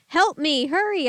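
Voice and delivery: high, loud scream; terrified call for speed. Acted. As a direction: scream to hurry. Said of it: "I screamed".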